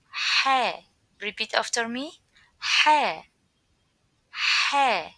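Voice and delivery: loud, breathy throat Ha; breathy spicy-food Ha. Shown as a demonstration. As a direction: push air out on Ha. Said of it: "like the sound when we eat spicy food".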